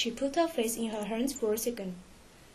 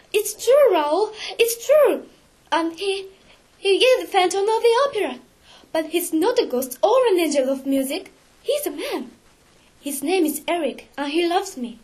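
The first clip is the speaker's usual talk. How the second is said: high, loud, agitated outburst; urgent insistence; 7 semitones higher, 7 dB louder than usual. A narrator's reading. Christine convincing Raoul she is not mad, urgent insistence. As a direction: insist urgently, pitch raised. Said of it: "said quickly".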